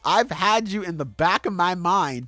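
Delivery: high, loud furious quote; angry rant. Retold or imitated. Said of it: "She's like".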